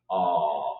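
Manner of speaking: "ah" low and open; open vowel. Shown as a demonstration. Teacher showing the English o sound as an open a. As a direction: say "ah" with the mouth rounded like O. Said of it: "Pongo boca de O, pero digo la A".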